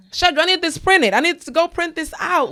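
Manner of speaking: high, loud, hurried pleading; mock-frantic boss calling her assistant; frantic demands. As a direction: call out to the assistant in a panic. Acting out her own frantic demands.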